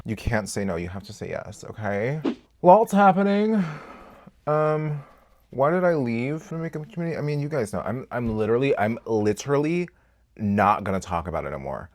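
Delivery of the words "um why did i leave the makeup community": ordinary talk, nothing marked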